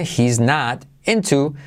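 "He's not into" slow, "not" with a flapped t, "into" with an e; soft R for T. Shown as a demonstration. Teacher demonstrates "He's not into" slowly for students. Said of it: "la T como R suave".

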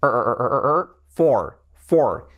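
"er" repeated, then "for" with the same strong R; firm English R ending "for". Shown as a demonstration. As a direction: drill the R, then carry it into a slow "for". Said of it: "con la R bien fuerte".

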